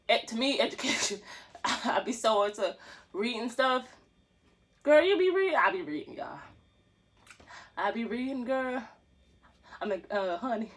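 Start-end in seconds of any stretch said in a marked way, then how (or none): none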